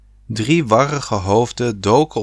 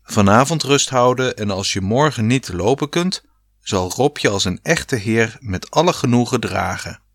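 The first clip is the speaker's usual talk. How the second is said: ordinary delivery, nothing marked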